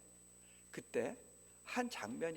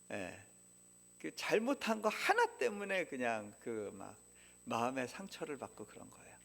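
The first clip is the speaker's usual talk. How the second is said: ordinary talk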